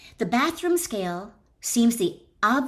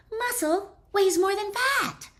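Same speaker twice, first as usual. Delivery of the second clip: much higher, put-on voice for "Muscle weighs more than fat"; mock self-convinced dieter; 13 semitones higher than usual. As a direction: parody the dieter's excuse in a silly high voice. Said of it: "you conclude".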